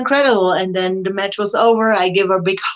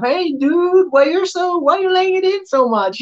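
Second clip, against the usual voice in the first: high, indignant inner protest, a lot higher; mock complaint; 10 semitones higher than usual. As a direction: playfully whine at an opponent hitting too hard. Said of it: "I'm thinking myself".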